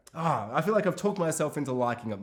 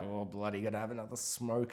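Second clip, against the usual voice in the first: lower, much softer, resigned grumble; weary husband mutter; 4 semitones lower, 9 dB quieter than usual. Retold or imitated. Husband brushing off his nagging wife, quiet and low. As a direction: sigh it off and go for a smoke. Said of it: "And he's like".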